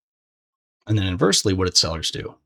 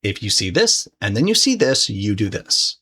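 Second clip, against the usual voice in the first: normal talking voice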